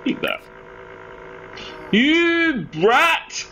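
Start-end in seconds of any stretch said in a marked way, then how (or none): 1.9-3.4 shrieked you brat, pitched way up; high mock outrage. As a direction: squeal it in fake fury.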